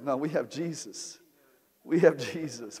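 ordinary talk, nothing marked

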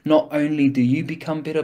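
normal talking voice

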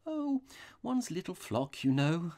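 high-voiced, innocent priest; blankly innocent. A narrator's reading. Priest explains his knowledge to the robber, blankly. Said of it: "arching his eyebrows rather blankly".

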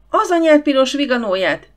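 high, loud shriek of outrage. A narrator's reading. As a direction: shriek at the dog as it smashes cups. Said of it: "sivított rá Mrs. Bird".

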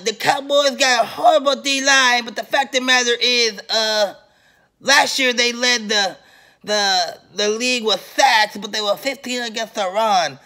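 high, mocking imitation of the rival fan's rambling speech; mock impression.

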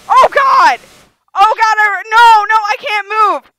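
loud panicked yelling; loud frightened gamer shout; real panic. Real, not acted. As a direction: scream that you're trapped. Real panic, trapped in game.

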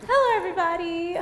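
high, loud hello into the room; loud, high-pitched hello; loud greeting. Real, not acted. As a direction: greet the crowd a bit too loudly. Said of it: "I'm loud".

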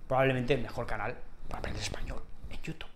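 soft unvoiced whisper; whispered slogan. Whispered channel slogan to viewers.

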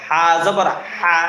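slow "হা জবর হা" with mid-throat Arabic ḥ; drawn-out spelling drill "হা জবর হা", pharyngeal h; hutti h. Shown as a demonstration. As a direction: stretch "হা জবর হা", squeezing each h in mid-throat. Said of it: "এবার আসুন আমরা হায় হুত্তিটা শিখি".

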